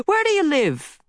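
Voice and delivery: put-on very high, sharp old woman's question; nosy old lady. Old lady startles boy with a question, very high. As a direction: pop the question out of nowhere.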